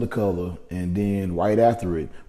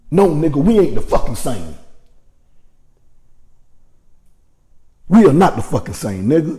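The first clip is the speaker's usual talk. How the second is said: higher, louder furious shouting; heated rant; 5 semitones higher, 5 dB louder than usual. Real, not acted. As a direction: let the real anger boil over. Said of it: "that shit had me so fucking heated".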